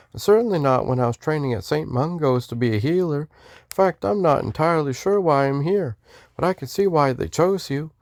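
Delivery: high-voiced Texan greeting in a Western accent. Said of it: "I was doing a Western accent".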